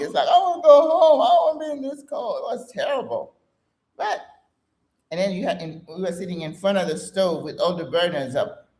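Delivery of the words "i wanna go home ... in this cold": high, fast, louder homesick whine; mock crying plea. Homesick teen in a freezing apartment, high mock wail. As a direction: wail it like a miserable eighteen-year-old. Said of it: "I was just bawling".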